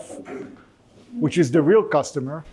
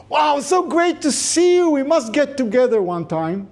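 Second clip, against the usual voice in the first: put-on gushing old friend; 10 semitones higher than usual. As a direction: gush like an overjoyed old friend. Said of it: "that's the polite American way".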